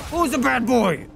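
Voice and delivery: high-pitched pet-scolding taunt; mock scolding a dog. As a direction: tease the beast like a naughty puppy.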